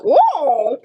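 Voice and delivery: loud, high parrot whoop; high squawked exclamation; parrot impression. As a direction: whoop like an excited pet bird. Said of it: "And then he'll go".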